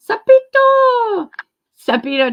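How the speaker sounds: gasping, high, drawn-out delighted greeting; light up at a friend showing up; excited gasp. Real, not acted. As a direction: gasp and call the name out, thrilled. Excited greeting to a chat member.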